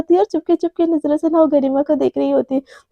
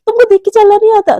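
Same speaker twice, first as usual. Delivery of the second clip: higher, louder annoyed challenge; irritated snap; 6 semitones higher, 5 dB louder, faster than usual. A narrator's reading. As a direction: snap at whoever bumped into you. Two bump and quarrel. (चिढ़कर ऊँची आवाज़ में)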